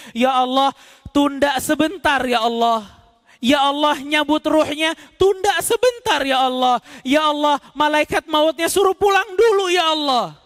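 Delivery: high, rising plea to God to delay death; desperate begging. Acted. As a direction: beg the angel of death to wait.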